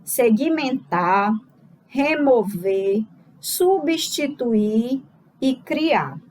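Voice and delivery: each verb said very slowly and set apart; slow, spelled-out verbs. Shown as a demonstration. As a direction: lay out the four verbs slowly, one by one. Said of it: "quatro verbos aí".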